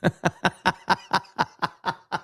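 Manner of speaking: long laugh in quick pulses; drawn-out amused cackle; real helpless laugh. Real, not acted. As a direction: crack up for a couple of seconds. Amused by the drunken typo.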